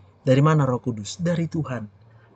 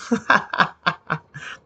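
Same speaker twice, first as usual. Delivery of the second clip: hearty laugh; 4 semitones higher than usual. Real, not acted. Doctor amused by own point, hearty laugh. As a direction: burst of repeated chuckles.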